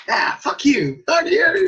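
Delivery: high, loud mock swearing; hammed-up angry yelp; rude mock outburst. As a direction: hurl the curse in a high, loud yell. Acted rude Canadian in New York.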